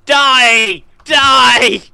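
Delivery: loud, high gleeful yell of Die; battle shout. Real, not acted. Gamer yelling at a mob while attacking, loud battle cry. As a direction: shout it as you strike. Fighting mobs in game.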